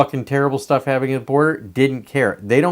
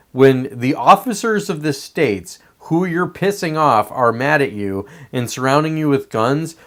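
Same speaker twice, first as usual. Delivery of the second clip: ordinary delivery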